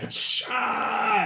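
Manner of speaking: high shouted cry of pain; pained yelp-laugh. Acted. Cyprianus whipped in the eye, high pained yelp.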